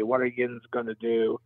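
usual speaking voice

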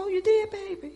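high, fake-innocent surprise; high feigned surprise; mock surprise. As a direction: play dumb sweetly. Mother feigning surprise to son.

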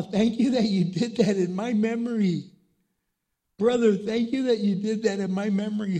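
high, loud, impassioned preacher climax; preaching at full force. Acted. Pastor urging mourners to live again, preaching at full force. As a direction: pour it out to the congregation at the peak.

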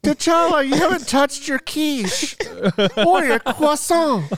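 mock French mother. Friend playing the French queen scolding T'Challa, high slow fussy voice. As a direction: fuss over him like a posh French mom.

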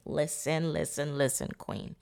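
hushed. Real, not acted. Softly drawing listener in.

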